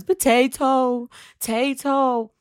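put-on high, slow little-boy potato; toddler saying potato. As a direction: stretch it out like a toddler asking. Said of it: "He goes".

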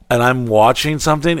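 ordinary talk, nothing marked

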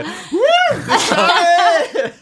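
put-on very high, drawn-out cheering kid; wild cheer. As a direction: whoop like an overexcited child.

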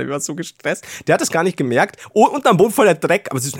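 ordinary talk

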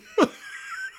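squeaky burst of real laughter; high giggle. Real, not acted. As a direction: let a high giggle escape. Laughing fit continues.

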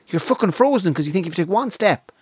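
ordinary talk

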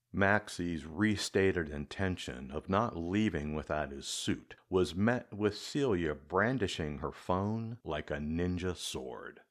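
ordinary delivery

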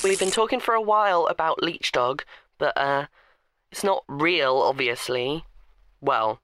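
put-on a snide teenage boy teasing; whiny teenage boy. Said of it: "in my whiny teenage boy voice".

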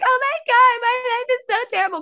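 very high, loud, rushed wail of self-pity; mock melodramatic whine. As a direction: ham up a drama queen falling apart. Mocking her former dramatic self.

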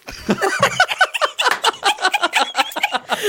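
long, high peal of laughter; high, sustained giggling fit; helpless laughter. Real, not acted. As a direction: crack up at your own admission. Laughs at own confession.

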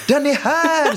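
put-on high triumphant kid yell; excited child shout. Son finding the shoe, high excited child shout. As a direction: shout it out like a triumphant little hero. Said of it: "skriker han".

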